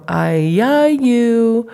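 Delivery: I-ya-you sung out high, three even syllables; babbled tripling. Shown as a demonstration. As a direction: chant I-ya-you high like to a toddler. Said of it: "like kitty cat".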